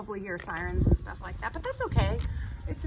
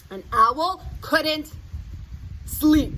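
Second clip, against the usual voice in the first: emphatic storytelling; 4 semitones higher, 7 dB louder, slower than usual. A narrator's reading. Story climax, louder.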